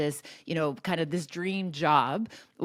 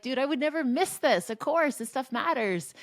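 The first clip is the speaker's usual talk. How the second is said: pitched way up, insisting she'd never miss it; a reassuring mom, voice lifted high; lightly acted eager promise; emphatic retold reply; 8 semitones higher than usual. Retelling her reply to her son.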